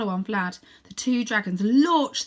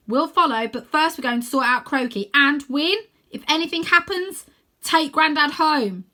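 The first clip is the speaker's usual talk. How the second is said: louder, higher steady orders, slowing at the end; raised firm call; 6 semitones higher, 7 dB louder than usual. Acted. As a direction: call out the plan firmly. Rose calls instructions.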